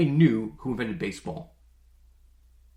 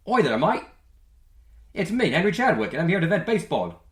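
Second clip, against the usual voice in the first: mock British accent, higher and louder, rushing the end; a hammed-up Brit; cartoonish British bloke, badly faked; 6 semitones higher, 4 dB louder than usual. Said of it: "that's such a bad accent".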